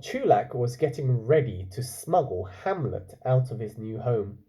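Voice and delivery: ordinary delivery, nothing marked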